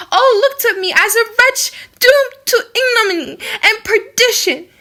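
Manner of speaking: high, loud, tearful pleading that breaks into sobs; a condemned girl crying out her despair, voice cracking; weeping. A narrator's reading. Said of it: "She paused, weeping".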